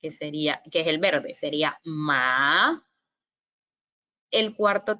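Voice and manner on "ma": slow "ma" dipping low, then jumping up; dipping third tone on "ma". Shown as a demonstration. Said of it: "es suave y baja y da como un salto".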